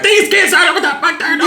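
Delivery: high mock grumpy old-timer, laughing; mock cranky elder. Mocking her own elder side, high and laughing. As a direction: play the grouchy elder complaining about kids. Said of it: "I'm like".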